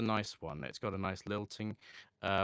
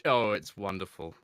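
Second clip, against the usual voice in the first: ordinary talk, nothing marked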